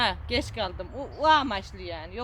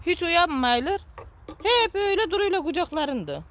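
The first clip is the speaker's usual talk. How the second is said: indignant; 8 semitones higher, 4 dB louder than usual. Real, not acted. Indignant about sleeping children.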